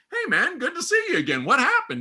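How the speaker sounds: high, delighted welcome-back; acted surprise and pleasure, high-voiced; excited greeting. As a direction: greet a long-lost buddy, retold with big pitch. Retold happy greeting of returning student.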